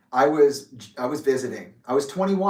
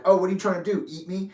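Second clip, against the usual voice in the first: retold playful teasing, pitched up; mock-protest, joking with dad; 5 semitones higher, faster than usual. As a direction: tease the dogs in a higher voice. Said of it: "I just jokingly was like".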